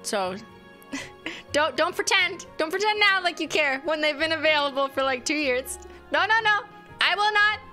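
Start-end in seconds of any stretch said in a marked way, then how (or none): none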